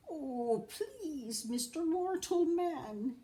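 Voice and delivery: high pleading little voice, slow; high, slow begging leprechaun. A narrator's reading. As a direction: beg the mortal to let you go. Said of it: "he pleaded".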